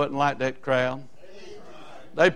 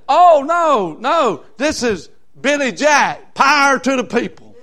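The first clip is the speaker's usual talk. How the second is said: put-on girl's excited shout; 7 semitones higher, 6 dB louder, slower than usual. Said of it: "She said".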